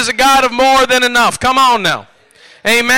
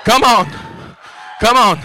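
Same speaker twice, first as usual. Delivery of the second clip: ordinary delivery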